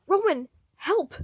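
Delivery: high, loud plea for help; desperate cry. A narrator's reading. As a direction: beg Roman to help. Virgil screaming for help.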